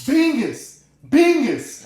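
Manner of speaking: high-pitched imitated holler; mock calling out. Friend imitated calling from the kitchen, high mock holler. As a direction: sing it out high, like a call across the house.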